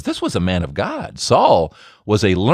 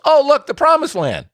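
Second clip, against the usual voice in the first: delighted wonder. Acted. Dying Moses sees promised land.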